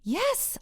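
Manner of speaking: a high, firm yes; emphatic yes. Retold or imitated. Answering Robert's offer, a high emphatic yes. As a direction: say it with total certainty. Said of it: "I say emphatically".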